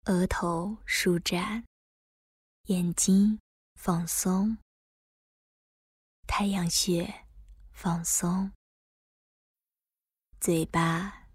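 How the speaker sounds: calm guide easing the body; guided meditation. Acted. Leads a body relaxation meditation.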